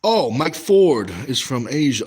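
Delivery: usual speaking voice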